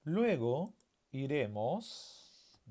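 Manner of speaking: very slow, word by word, voice lifting; stretched-out classroom dictation. Shown as a demonstration. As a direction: dictate it in pieces, leaving each hanging. Teacher reads out sentence in pieces.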